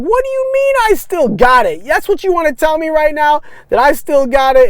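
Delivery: loud, very high mock indignation; incredulous outburst. Husband to wife laughing it off, loud high disbelief. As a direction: throw it back at her, high and flabbergasted. Retold protest to amused wife.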